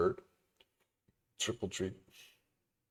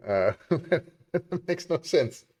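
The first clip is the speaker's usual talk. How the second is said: laughing; 7 semitones higher, slower than usual. Real, not acted. Laughing at chat request.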